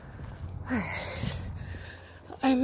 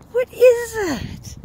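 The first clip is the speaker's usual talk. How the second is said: much higher, slow wonder; slow, high-pitched astonished what is that; amazed high question; 13 semitones higher, 6 dB louder, much slower than usual. Real, not acted. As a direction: gasp it out slowly in surprise. Seeing red robot things.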